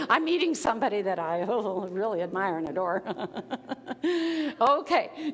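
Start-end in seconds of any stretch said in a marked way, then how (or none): 3.1-4.5 nervous laugh. Shown as a demonstration. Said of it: "That's nervous laughter".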